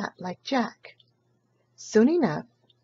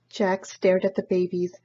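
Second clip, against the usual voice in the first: usual speaking voice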